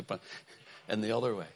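normal talking voice

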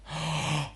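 audible breathed-in gasp; demo inhale. Shown as a demonstration. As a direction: draw air in sharply through the mouth. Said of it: "I'm not very good at doing this, but I'm gonna try".

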